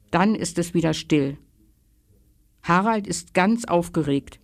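plain reading voice

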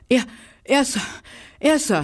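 breathless winner's pant. Acted. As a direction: pant it out after the race. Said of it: "hechelte er".

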